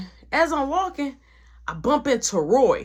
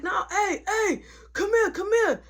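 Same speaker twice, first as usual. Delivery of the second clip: high call after someone walking away; 11 semitones higher than usual. Retold or imitated. Roy calling after the narrator as he walks off, pitched-up shout. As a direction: call him back, pitched up.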